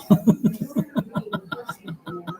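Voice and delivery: high breathless giggle. Real, not acted. Laughing at his own pronunciation lesson, high giggle. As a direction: let a high airy giggle run.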